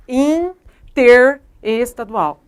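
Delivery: "interestadual" broken as in-ter, then estadual, loud and slow; wrong syllable split. Shown as a demonstration. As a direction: split "interestadual" after the prefix inter, with pauses. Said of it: "E não é".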